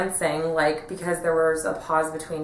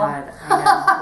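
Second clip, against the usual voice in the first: laughing; 12 semitones higher, 6 dB louder than usual. Real, not acted. Laughing at own jargon.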